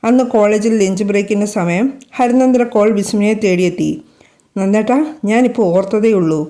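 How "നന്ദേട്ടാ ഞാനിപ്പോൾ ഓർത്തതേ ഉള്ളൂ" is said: ordinary delivery, nothing marked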